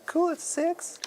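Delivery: high squeaky mock repeat; mocking falsetto echo. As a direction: squeak it back at him.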